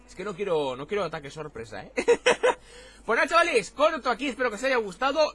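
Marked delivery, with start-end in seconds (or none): 2.0-3.0 loud, high chuckling; burst of laughter. Real, not acted. Streamer laughing before signing off, high chuckle.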